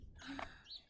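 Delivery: sharp hushed gasp of mock horror; mock shocked gasp. Reader reacts to rat's deeds.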